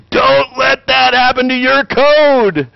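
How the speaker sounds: slow, high, grave warning; a lecturer's solemn caution; teacher warning students about code, slow and high; stern professor warning. Acted. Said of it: "and say".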